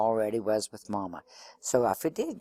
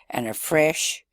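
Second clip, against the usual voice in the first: usual speaking voice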